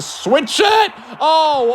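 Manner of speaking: rising shout into a high "Oh"; excited call. Acted. Commentator reacts to a big kick.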